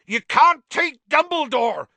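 high, very loud protest; yelling. A narrator's reading. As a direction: yell it in outrage. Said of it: "yelled Hagrid".

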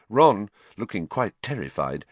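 ordinary delivery

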